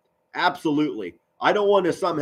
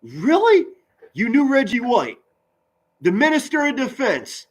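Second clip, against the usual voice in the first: much higher, excited disbelief; amazed; 9 semitones higher than usual. Real, not acted. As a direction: gasp it out, floored by the news. Amazed at viewer knowing Reggie.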